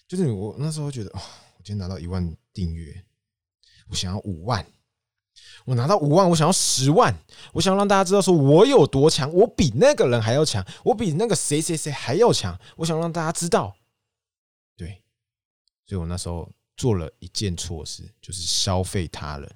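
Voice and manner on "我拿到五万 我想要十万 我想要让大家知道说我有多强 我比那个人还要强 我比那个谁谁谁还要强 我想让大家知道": high, loud, heated boasting; greedy bragging. Retold or imitated. Younger self craving more subscribers, greedy boasting. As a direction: rush upward with hungry ambition. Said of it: "就是出于一个贪婪的心".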